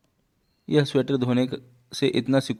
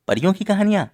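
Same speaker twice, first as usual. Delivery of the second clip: high, excited question; delighted surprise; 9 semitones higher, 4 dB louder, faster than usual. Acted. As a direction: exclaim it with childlike delight, pitched way up. Girl offered fairy tale book.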